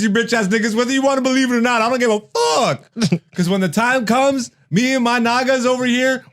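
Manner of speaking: ordinary talk, nothing marked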